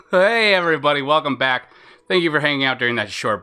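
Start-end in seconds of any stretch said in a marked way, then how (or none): none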